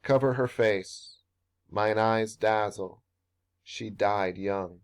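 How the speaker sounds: put-on "Cover her face", "Mine eyes dazzle", "She died young" split by clear pauses, slowing down; marked caesura pauses. Shown as a demonstration. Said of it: "Consider the pauses in the following passage".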